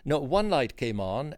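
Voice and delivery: ordinary talk, nothing marked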